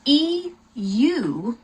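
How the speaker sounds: slow letter-by-letter spelling; teacher dictating letters one by one. Shown as a demonstration. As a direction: spell each letter slowly and clearly. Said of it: "if you wanna say Europe, that's".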